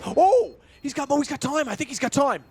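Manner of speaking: excited. Real, not acted. Caster tense over defuse.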